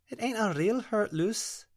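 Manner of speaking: high, lilting Scottish brogue; a gentle Scots spirit coaxing the girl; Scottish dream spirit. Acted. Alpina's Scottish voice.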